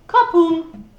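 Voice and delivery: loud booming crash word; Kapoom effect. Acted. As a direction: slam it down like a fall. Sound of the fall.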